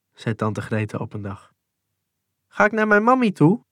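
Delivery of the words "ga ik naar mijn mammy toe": put-on a touch higher, a child's hopeful question; lightly voiced small child. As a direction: ask it lightly as a small boy. Kind vraagt naar zijn moeder. (licht gespeelde kleine jongen)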